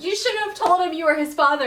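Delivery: a hammed-up rebuke; mock scolding.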